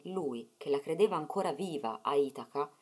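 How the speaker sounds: usual speaking voice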